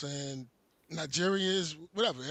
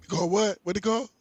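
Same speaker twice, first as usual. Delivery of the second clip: usual speaking voice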